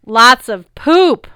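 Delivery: loud, slow matter-of-fact boom; blunt observation. A narrator's reading. Big boy observing the stables, loud slow boom. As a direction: state it plainly and big. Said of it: "Tyson observed".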